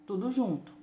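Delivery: ordinary talk, nothing marked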